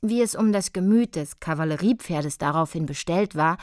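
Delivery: ordinary delivery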